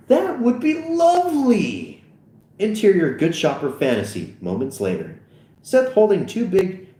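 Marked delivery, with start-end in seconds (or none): 0.1-2.0 put-on slow, very high falsetto old lady. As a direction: draw it out as a delighted old lady. Old lady accepts alcohol offer.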